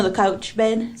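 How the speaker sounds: normal talking voice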